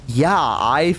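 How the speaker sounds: slow, stretched-out uneasy agreement; acting out wary, drawn-out politeness; a little scared. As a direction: drag out a nervous fake yes. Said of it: "like, a little scared now".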